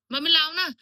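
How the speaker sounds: loud, high call to mum. Real, not acted. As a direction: call out to her across the house. Calling mum from upstairs.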